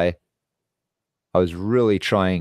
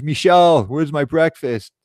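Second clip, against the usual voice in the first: high, louder mock yell for his wife; imitated yell; 7 semitones higher, 3 dB louder than usual. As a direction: imitate a boss calling out to his wife, drawing it out. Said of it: "he yells for his wife".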